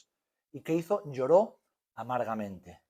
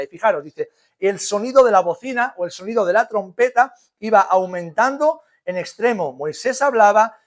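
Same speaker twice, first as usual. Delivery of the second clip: loud, ringing Bible reading; scripture reading; 5 semitones higher, 9 dB louder than usual. Acted. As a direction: proclaim the text to the congregation. Reads Exodus aloud. (lectura bíblica proclamada en voz alta)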